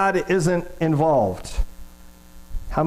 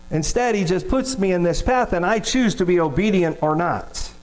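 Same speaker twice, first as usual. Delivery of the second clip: usual speaking voice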